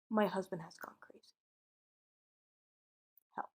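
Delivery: hushed whisper into the phone; scared whisper. Retold or imitated. Wife secretly phoning police, frightened whisper. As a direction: whisper it so the husband won't hear.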